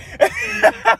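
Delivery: very high crying-laugh shriek. Real, not acted. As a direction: burst into a screaming, tearful laugh. Reacting to insult from resident.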